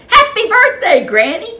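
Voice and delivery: high, loud joyful cheer; a child cheering for Granny; excited greeting. A narrator's reading. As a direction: burst out happily.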